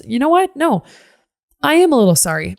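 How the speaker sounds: high snap of realisation; sudden change of heart. Real, not acted. To listeners, taking back her claim, high and sudden. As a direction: catch yourself mid-thought.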